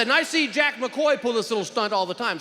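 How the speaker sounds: high, emphatic courtroom outburst; indignant protest. Acted. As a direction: protest loudly as an opinionated juror. Acted protest to defense attorney.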